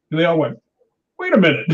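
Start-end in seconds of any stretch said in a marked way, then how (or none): 1.2-1.7 higher imitation of a sudden protest; mock alarm. Intruders balking as the gate opens, mock alarm. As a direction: back down abruptly, playfully acted.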